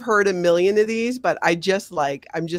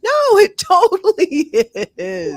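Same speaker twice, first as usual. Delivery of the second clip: real laughing outburst, pitched way up; laughing exclamation; 12 semitones higher, 7 dB louder than usual. Real, not acted. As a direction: cry it out emphatically while laughing. Laughing agreement, exclaims over sexism.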